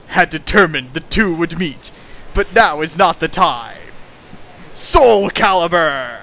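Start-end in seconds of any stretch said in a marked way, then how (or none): 4.7-6.2 announcer shout. Acted. Announcer-style mock shout.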